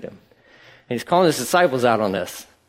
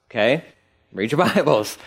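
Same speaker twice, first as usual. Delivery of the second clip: normal talking voice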